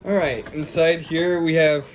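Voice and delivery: usual speaking voice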